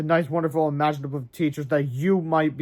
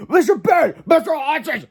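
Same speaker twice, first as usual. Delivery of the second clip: high, loud shouted names; screamed again; 12 semitones higher, 7 dB louder than usual. Acted. Old Mister Crump screaming at the teachers once more. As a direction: bellow both names at full force. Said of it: "he screamed again".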